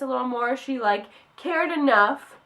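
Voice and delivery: normal talking voice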